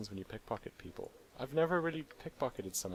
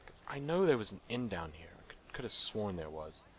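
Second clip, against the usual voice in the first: normal talking voice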